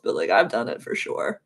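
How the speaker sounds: ordinary delivery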